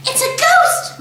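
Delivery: put-on high, alarmed girl; mock alarm. A narrator's reading. Matilda announcing a ghost to scare parents, high. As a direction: feign fright at a ghost. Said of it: "Matilda said".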